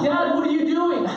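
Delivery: put-on high-pitched child's question; pestering kid. Kid knocks and questions dad, high mock child voice. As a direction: imitate a nosy kid at the door. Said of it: "They'll knock".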